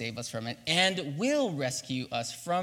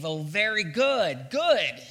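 smug approval; 4 semitones higher, 7 dB louder, much slower than usual. Acted. King mockingly approving worshippers.

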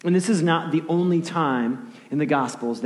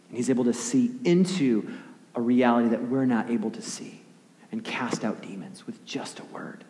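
ordinary talk

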